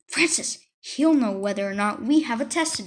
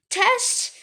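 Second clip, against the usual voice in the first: alarmed realization; 11 semitones higher than usual. Acted. Sudden panic over a test.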